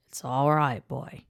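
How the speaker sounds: low Australian man's voice; Australian accent. A narrator's reading. Man comforting an injured boy, Australian accent. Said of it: "the man said in an Australian accent".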